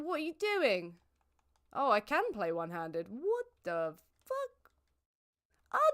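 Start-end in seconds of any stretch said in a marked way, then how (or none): 3.1-5.8 stunned disbelief. Real, not acted. Shocked at game event.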